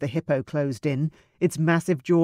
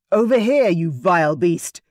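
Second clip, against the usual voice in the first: yell; 6 dB louder than usual. A narrator's reading. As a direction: taunt the beast to draw it off. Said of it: "Julian yelled".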